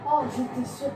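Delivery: loud exasperated cry; frustrated shout. Real, not acted. Dies in the game. (cri exaspéré)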